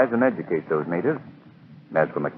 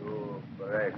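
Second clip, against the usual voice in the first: low, hushed doom; a lightly voiced avenging native god; menacing spirit; 3 semitones lower, 9 dB quieter, much slower than usual. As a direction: pronounce a death sentence quietly. Idol's avenger threatens him.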